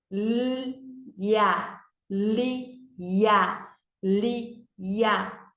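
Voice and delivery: Lia split into "li... ia"; syllable by syllable. Shown as a demonstration. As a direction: say Lia slowly in two separate beats. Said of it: "Duas sílabas". (Lia em sílabas: "li... ia")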